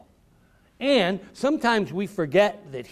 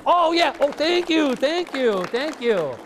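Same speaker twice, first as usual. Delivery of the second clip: mock acceptance; 8 semitones higher than usual. Author mock-accepts applause.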